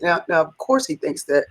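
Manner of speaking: normal talking voice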